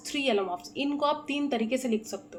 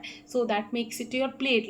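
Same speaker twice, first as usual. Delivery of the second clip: ordinary delivery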